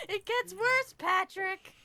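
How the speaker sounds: high, laughing through the words. Real, not acted. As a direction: giggle while confessing.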